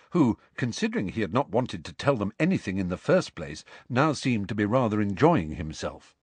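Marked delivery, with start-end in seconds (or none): none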